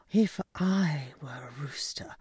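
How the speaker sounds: hushed conspiratorial whisper; whispered gossip. Acted. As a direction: whisper it like a secret. Hen whispers the scandal.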